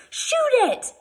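shrill high commanding shout; a lady's panicked command to fire; ordered. Acted. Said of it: "I ordered".

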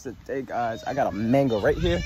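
ordinary talk

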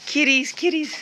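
high coaxing kitty call; calling kitties. Real, not acted.

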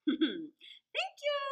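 sweet as honey, mocking; sweetly. Fox thanking the crow sweetly. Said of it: "said Master Fox sweetly".

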